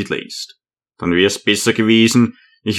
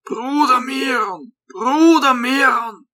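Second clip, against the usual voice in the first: put-on much higher, slow calling old hermit; old man calling; 12 semitones higher, slower than usual. A narrator's reading. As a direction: call out to the brother behind the gate. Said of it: "Der Alte begann daran zu klopfen und rief".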